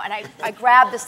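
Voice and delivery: usual speaking voice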